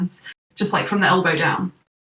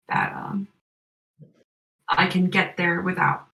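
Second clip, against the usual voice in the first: Elijah voice. Acted. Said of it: "Elijah just says".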